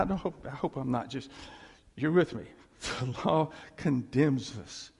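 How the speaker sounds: usual speaking voice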